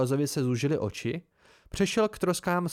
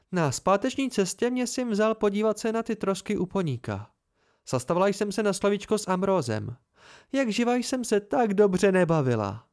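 put-on higher young woman's gleeful voice; higher female voice, delighted; Fela gloating; 6 semitones higher than usual. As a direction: relish the mischief.

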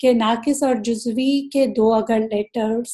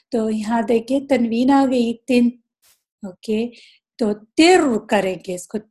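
ordinary talk, nothing marked

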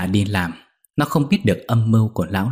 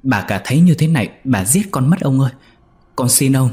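higher, louder terrified begging; stammering plea, voice pitched up in fear; frightened pleading; 5 semitones higher, 5 dB louder than usual. Acted. Young maid begging the master to stop, frightened and high. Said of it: "Con Nhị kêu lên".

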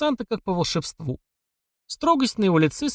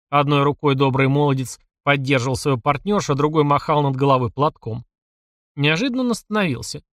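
plain reading voice

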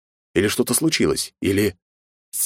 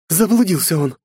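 exasperated mother; scolding while shaking him; being shaken; 11 semitones higher, 4 dB louder than usual. A narrator's reading. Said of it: "встряхнула сына за плечи". (мать трясёт сына)

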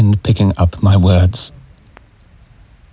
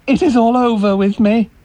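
despair; 10 semitones higher than usual. Acted. Despairing outburst.

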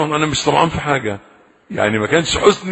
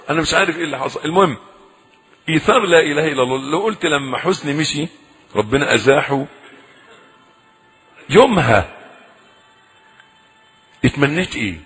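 ordinary delivery

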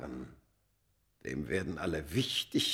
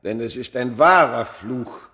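louder firm denial; emphatic insistence; 3 semitones higher, 9 dB louder than usual. Acted. The man rejecting superstition to Smith, loud emphatic. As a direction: hammer the words home.

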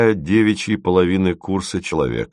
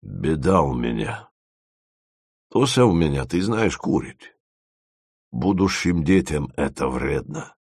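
soft, low, embarrassed confiding; bashful confession; 5 semitones lower, 4 dB quieter than usual. A narrator's reading. Dima confides his trouble with Tosya, bashful. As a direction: confess it sheepishly, keeping it down. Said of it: "вымолвил он стыдливо".

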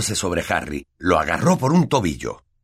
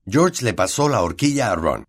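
ordinary delivery

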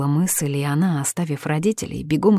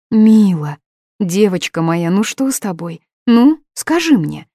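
worried mother; 4 semitones higher, 7 dB louder, slower than usual. Acted. Mother comforting sobbing daughter.

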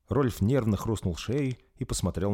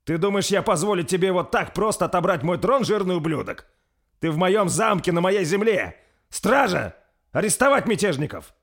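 higher, louder enraged prince; furious command; 10 semitones higher, 6 dB louder than usual. A narrator's reading. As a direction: order the guards in fury. Prince orders arrest. (принц в гневе приказывает страже)

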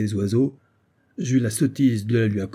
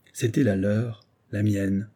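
ordinary delivery, nothing marked

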